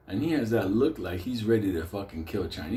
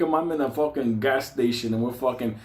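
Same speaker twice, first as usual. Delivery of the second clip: ordinary talk, nothing marked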